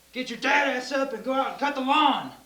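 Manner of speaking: loud, high gruff dad bark; mock yell of a stern dad. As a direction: bark it like an angry father. Dad voiced in retold story.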